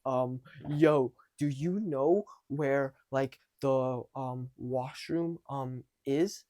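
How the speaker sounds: halting, drawn out, full of gaps; awkward hesitation. Shown as a demonstration. Awkward guy asking a stranger for the washroom, hesitant. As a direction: stall and hesitate between the words. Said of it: "simply not normal".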